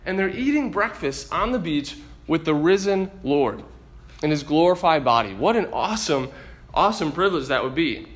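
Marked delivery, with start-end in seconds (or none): none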